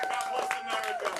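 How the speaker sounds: grand sign-off. Real, not acted. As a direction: belt it out like a patriotic closer.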